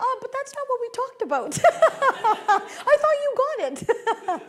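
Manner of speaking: high and loud, breaking into laughter; laughing. Real, not acted. Telling the audience about her son, laughing. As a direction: laugh through the protest.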